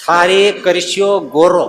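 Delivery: put-on rural regional accent, ળ said as ર in ગોરો. Preacher mimics villagers of a region who say ર for ળ. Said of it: "અળ ન બોલી શકે".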